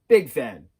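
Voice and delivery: a loud burst of fandom; big loud declaration; excited. Real, not acted. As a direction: belt it out like a proud fan. Emphatic fan declaration, then repeated.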